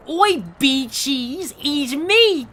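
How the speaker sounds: mock Australian shout-out in a lightly voiced Gondor; Gondor's Aussie accent. Imagined fan comment voicing Gondor, mock Australian accent. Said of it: "written out phonetically in Gondor's accent".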